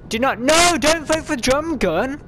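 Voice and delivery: high, loud, slow and drawn-out "no"; frantic pleading. Real, not acted. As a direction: beg the lobby to stop. Begging players not to vote.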